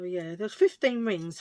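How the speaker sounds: ordinary delivery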